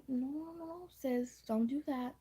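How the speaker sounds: high warning. Retold or imitated. Stopping girl from hitting.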